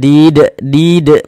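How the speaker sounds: "did" bounced hard and loud off the final d; qalqalah bounce. Shown as a demonstration. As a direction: punch "did" with an echoing d at the end. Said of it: "ini kita pantulkan". ("did" dipantulkan keras)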